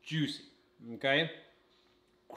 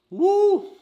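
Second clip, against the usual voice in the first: excited whoop; 14 dB louder than usual. Real, not acted. Biting hot drumstick.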